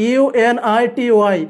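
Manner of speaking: letter by letter for the students; spelled out letter by letter. Shown as a demonstration. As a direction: spell U N I T Y one letter at a time. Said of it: "ভালো করে বোঝার চেষ্টা করবা".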